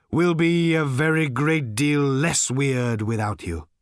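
slow, intoned blessing; solemn pronouncement. A narrator's reading. Old Thrashbarg blesses departing Arthur, pronouncing solemnly. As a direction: deliver it slowly as holy prophecy.

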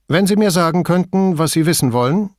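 clipped and impatient, demanding; brusque. A narrator's reading. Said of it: "fragte Signora Toso barscher".

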